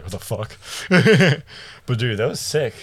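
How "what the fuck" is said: slow stunned disbelief. Retold or imitated. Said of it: "I was like".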